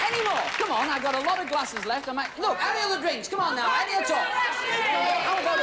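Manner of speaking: high-pitched shout to the crowd; calling for orders. Real, not acted. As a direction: drum up orders like a barker. Calling to audience for drink orders.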